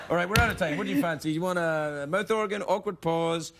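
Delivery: usual speaking voice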